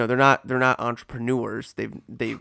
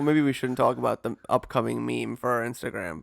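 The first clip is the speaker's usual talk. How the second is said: ordinary talk, nothing marked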